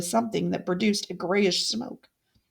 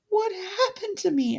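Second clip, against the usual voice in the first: frightened question; 11 semitones higher than usual. Acted. Frightened ghost asks.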